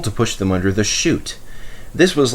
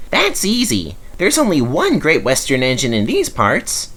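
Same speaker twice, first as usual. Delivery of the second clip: small engine Rex voice; 6 semitones higher than usual. A narrator's reading. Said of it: "smiled the small engine".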